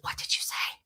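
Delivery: whispered stunned question; hushed disbelief. Retold or imitated. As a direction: breathe it out. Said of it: "he goes".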